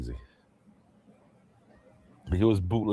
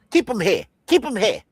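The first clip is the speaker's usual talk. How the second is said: high, louder mock order; high, louder hammed-up gangster command; 11 semitones higher, 5 dB louder than usual. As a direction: bark the order pitched way up.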